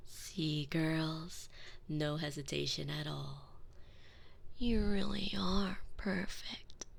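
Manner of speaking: slow predatory whisper. Acted. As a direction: murmur it slowly, hungry and gentle. Before biting the maid.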